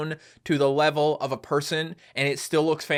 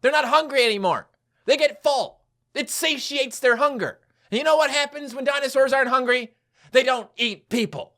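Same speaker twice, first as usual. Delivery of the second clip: exasperated lecturing; 6 semitones higher than usual. Acted. Exasperated explanation of failed plan.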